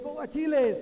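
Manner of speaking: loud, high call of encouragement; cheering shout. Retold or imitated. Runner cheering on a clubmate, loud high shout. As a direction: shout it out to spur him on.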